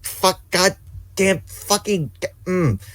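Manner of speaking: high, halting curses; sputtering frustration. Real, not acted. Player running out of words over game sidekick, frustrated. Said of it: "Okay, I'm better".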